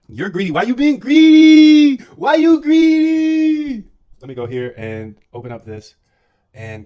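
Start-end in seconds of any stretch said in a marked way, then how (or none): 0.1-3.9 high, slow, stretched-out mock shout; teasing drawn-out yell. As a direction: drag out "greedy" in a shrill teasing wail. Teasing a greedy viewer.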